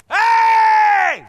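high, loud desperate scream. Retold or imitated. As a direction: scream for attention with all you have. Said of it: "I mean, it was a scream".

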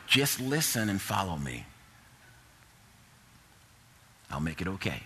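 low, soft, unhurried reassurance; gentle inner assurance. Retold or imitated. Retelling God's inner impression, gentle assurance.

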